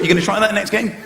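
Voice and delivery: normal talking voice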